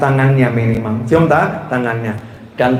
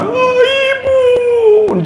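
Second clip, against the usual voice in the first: high, drawn-out wail for mother; acted wail that drops away into pleading; mock grieving cry; much slower than usual. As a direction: cry out too late to a dead parent, hammed up. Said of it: "baru teriak-teriak".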